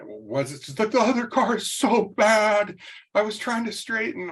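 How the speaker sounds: put-on high, anxious teen voice; imitating his daughter, high and flustered. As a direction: explain the fender bender in a panicked rush. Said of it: "freaking out".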